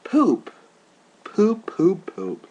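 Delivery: high, loud sulky mock; mocking Snooki. Mocking Snooki's sulk, high and loud. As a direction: pout it out like a sulky girl. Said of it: "so she's like".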